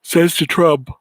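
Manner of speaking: words stretched by a yawn. Real, not acted. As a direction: say it mid-yawn. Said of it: "pardon me, yawning".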